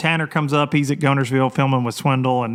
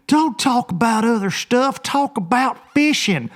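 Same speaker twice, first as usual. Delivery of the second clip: mock whiny viewer; impatient viewer voice; 9 semitones higher than usual. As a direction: play a pushy fan nagging to get to the fishing. Voicing impatient viewers' demand.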